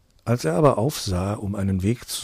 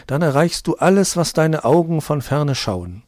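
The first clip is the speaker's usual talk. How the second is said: high, loud demonic call; shrieking demon; 7 semitones higher, 6 dB louder than usual. Acted. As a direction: shout it like a greedy demon. Second demon tempts Mantau.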